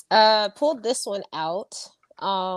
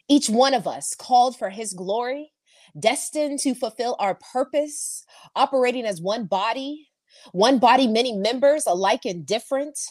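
rising spoken-word poem; poem recital; 5 semitones higher than usual. Acted. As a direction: declaim it like a call. Recites his poem Unity.

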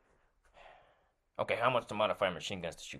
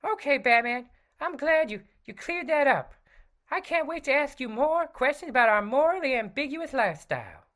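high, bright sidekick voice; Robin voice; 4 dB louder than usual. Acted. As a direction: play the eager young sidekick.

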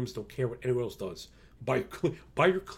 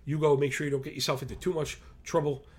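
ordinary delivery

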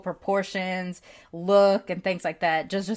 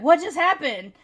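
higher, louder puzzled grandma; confused grandma; 8 semitones higher, 5 dB louder than usual. Retold or imitated. Grandma asks grandchild what strangers said, puzzled. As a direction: voice her as a baffled old lady. Grandma voiced in retold story.

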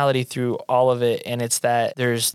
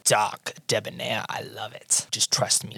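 much softer, confident couturier voice; softly purring designer; 6 dB quieter than usual. Acted. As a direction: murmur approval like a doting designer. Pepper voiced by GM.

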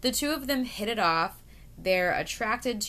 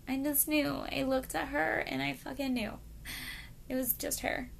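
mock lovestruck Eileen; 6 semitones higher, 5 dB quieter than usual. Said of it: "I don't know why I said it like that".

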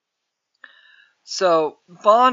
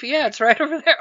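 put-on high girl voice; 9 semitones higher, faster than usual.

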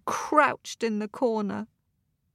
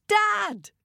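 high call out to a parent; shouted call; 11 semitones higher, 3 dB louder than usual. A narrator's reading. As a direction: shout for Dad across the street. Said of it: "shouted Ted".

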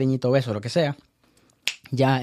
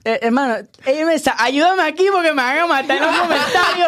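laughing plea; 11 semitones higher than usual. Real, not acted. Laughing, fears the comments.

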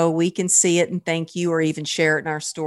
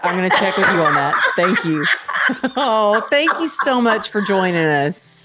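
laughing. Real, not acted. Laughing while thanking guest.

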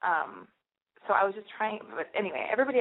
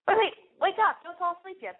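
higher, louder jolt to wake listeners; mock alarm; 10 semitones higher, 4 dB louder than usual. Joking to listeners who might doze off, mock alarm. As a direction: playfully jolt the audience awake.

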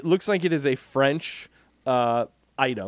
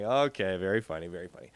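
unimpressed teacher; 6 semitones lower, 5 dB quieter, faster than usual. Retold or imitated. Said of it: "teacher kinda rolled her eyes".

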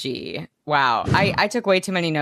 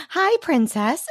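high sugary princess greeting; cartoon princess; 9 semitones higher, slower than usual. Voicing park princess.

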